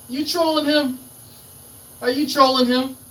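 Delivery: high, loud incredulous question; amused disbelief. Real, not acted. Host catches Fred trolling someone, amused disbelief. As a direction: pipe it up, tickled and disbelieving.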